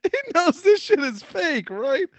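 high and slow, each word stretched out; slow, high, stretched-out disbelief; drawn-out incredulous. Real, not acted. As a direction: drag it out in disbelief. Repeats the line more slowly.

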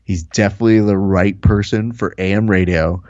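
usual speaking voice

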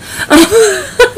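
a burst of high laughter at herself. Real, not acted. Podcaster laughing at her own bad accent.

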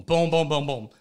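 normal talking voice

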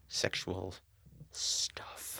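hushed, embarrassed whisper. Real, not acted. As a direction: drop to a whisper on it. Drops to whisper on taboo word.

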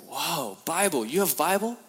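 high, loud amazed question. Retold or imitated. Chinese prisoners amazed he has a Bible, high and loud. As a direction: gasp in wonder at the Bible.

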